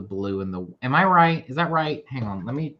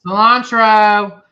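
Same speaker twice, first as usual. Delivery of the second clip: excited exclamation; 8 semitones higher, 11 dB louder, slower than usual. Real, not acted. Excitedly repeats the word.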